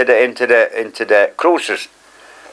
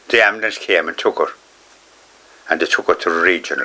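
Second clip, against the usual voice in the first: usual speaking voice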